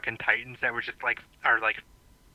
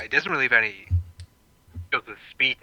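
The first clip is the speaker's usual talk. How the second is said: normal talking voice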